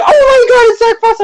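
put-on girl's excited voice. Cosplayer behind him spotting him, high excited girl voice. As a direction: squeal it like a starstruck cosplayer. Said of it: "it wasn't high pitched, but it was, it was still a girl's voice".

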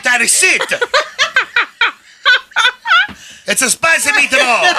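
Italian accent. Shown as a demonstration. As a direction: ham up the Italian while laughing. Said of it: "you have to do that one again".

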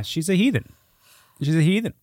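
ordinary talk, nothing marked

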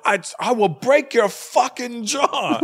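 high, very slow mock threat; threatening yell. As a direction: spell the threat out slowly. Retold bar threat, repeated.

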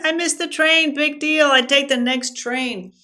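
loud, high-pitched self-talk; emphatic dismissal. Retold or imitated. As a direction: wave the worry away at full volume. Self-talk shrugging off missed train.

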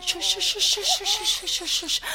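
rhythmic soothing shush. Acted. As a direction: shush the baby to sleep. Shushing the baby.

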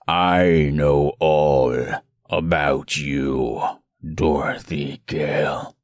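very low, slow spitting menace; spitting monster. Acted. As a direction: spit the words out slowly. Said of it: "as it spoke, it spat".

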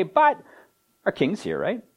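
mock confidence, high jump on the first word; lightly cocky, tossing off a checking question; cocksure. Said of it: "in a cocksure kind of way".

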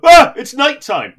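high, loud and slow; hammed-up loud cry; mock announcement. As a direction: call it out in a big mock voice. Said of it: "he'll go".

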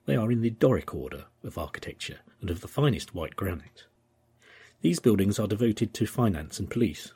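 measured reading of a historic travel journal. Acted. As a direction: read the period text with formal dignity. Reads the old journal aloud.

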